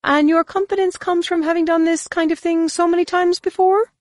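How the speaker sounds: very high, quick alien voice; Droma's retort. Acted. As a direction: snap back in a squeaky alien voice.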